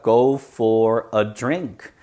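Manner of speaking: slow, evenly separated model phrase; slow word-by-word. Shown as a demonstration. Teacher explaining the phrase to students, slow and separated. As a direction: give each word its own space.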